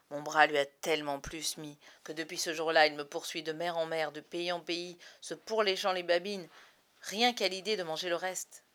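ordinary delivery, nothing marked

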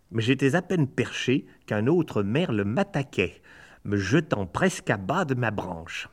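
ordinary delivery, nothing marked

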